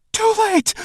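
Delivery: very high, loud scream; panicked scream as the stinger falls. Acted. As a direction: shriek the warning. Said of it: "Bashira screams".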